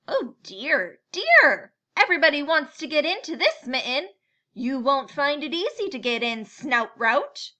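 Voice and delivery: loud, fast, flustered with a squeak on dear; all in a tizzy, loud and quick; fretting animals. Acted. Animals warning the boar off, loud and flustered.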